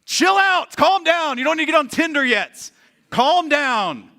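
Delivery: high, loud mock telling-off; exasperated yelling. Preacher telling a panicking worrier to relax, exasperated yelling. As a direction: shout them down in acted exasperation.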